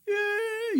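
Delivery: high, drawn-out mock sobbing yes; tearful answer. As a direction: imitate a man answering through streaming tears. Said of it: "눈물 콧물 막 쏟으면서". (울먹이며 길게 대답하는 흉내)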